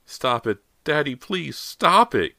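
high plea, building to a cry; miserable pleading. A narrator's reading. Girl begging her father to stop the goo, pleading miserably. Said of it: "Callie pleaded miserably".

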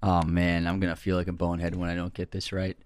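ordinary delivery

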